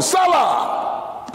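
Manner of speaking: sudden high shout, pitch jumps way up; shouted Selah. Real, not acted. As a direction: fling it out to the congregation. Preacher calls out mid sermon.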